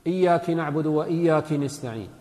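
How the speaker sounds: both "إياك" said iyyaki; feminine-address error. Shown as a demonstration. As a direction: put an i after the kaf of each "إياك". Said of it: "لأنه صار الخطاب للمؤنث".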